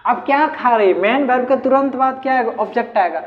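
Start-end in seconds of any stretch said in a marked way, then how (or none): none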